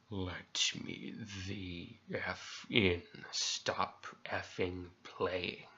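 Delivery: low, soft, very slow halting creature; halting weird voice. Retold or imitated. Creature at the trailer door begging to get in, halting weird voice. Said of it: "halting, weirdly toned voice".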